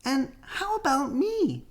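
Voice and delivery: put-on high, eager little girl bear; little Sister voice. A narrator's reading. Sister cub asking her father, high and eager. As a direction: ask like a small cub who doesn't want to be left out. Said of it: "Sister wanted to know".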